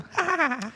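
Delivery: very high cartoon cackle; SpongeBob laugh. Navigation voice chiming in, high cartoon laugh. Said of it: "Mine's SpongeBob".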